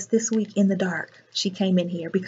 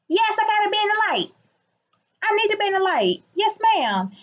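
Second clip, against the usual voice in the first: mock high exclaim; 10 semitones higher than usual.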